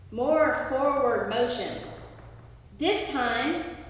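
ordinary talk

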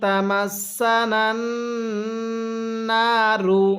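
drawn-out chanted recitation; slow melodic Quranic chant. Shown as a demonstration. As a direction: recite it like a tajweed teacher. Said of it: "আমার সাথে সাথে মুখে মুখে পড়বেন".

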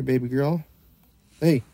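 normal talking voice

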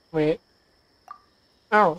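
normal talking voice

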